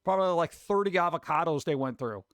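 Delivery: usual speaking voice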